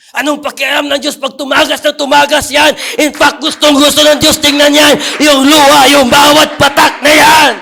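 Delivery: fervent preaching push. Acted. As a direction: press the point home with fervor. Preaching rises again.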